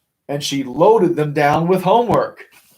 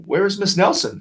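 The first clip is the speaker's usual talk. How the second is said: put-on kids asking; 6 semitones higher than usual. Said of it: "asked the kids".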